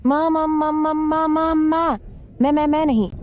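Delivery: high, rapid stuck syllables on one held pitch; panicked stammering. Acted. Girl refuses to go to hospital, stammering in panic. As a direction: stutter helplessly, high and unbroken, into a refusal. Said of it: "छोटी हकलाने वाली".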